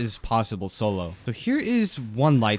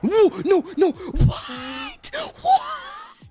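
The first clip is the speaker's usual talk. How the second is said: raging gamer; 3 dB louder, much slower than usual. Real, not acted. Dies again, shrieking disbelief.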